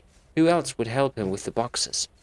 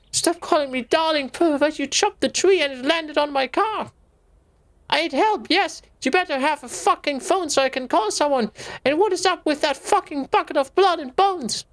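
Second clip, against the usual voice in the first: much higher, indignant scolding; angry Anne; 5 dB louder than usual. Acted. Anne scolding the groundkeeper, high and indignant. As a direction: scold the creepy man.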